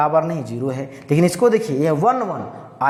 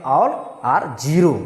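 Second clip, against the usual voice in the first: drawn out slowly; much slower than usual. Shown as a demonstration. Teacher drives home the rule.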